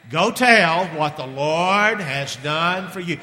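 "Go tell what the Lord has done for you" spoken slowly, every word distinct; slow unison phrase. Shown as a demonstration. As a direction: lead the congregation through it slowly. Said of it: "Let's say it together".

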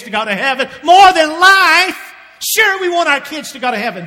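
soar up and belt out the point; impassioned preaching. Real, not acted. Preacher asks fathers about their kids' salvation, impassioned cry. Impassioned rhetorical question and answer.

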